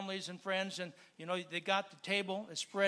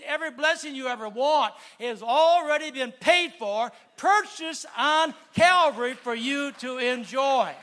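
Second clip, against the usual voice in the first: louder, rising sermon voice; fervent preacher build; preaching; 6 semitones higher, 9 dB louder than usual. Real, not acted. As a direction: drive the promise home to the congregation.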